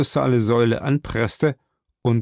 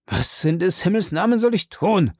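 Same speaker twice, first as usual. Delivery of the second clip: utmost despair; 6 semitones higher than usual. Acted. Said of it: "in höchster Verzweiflung".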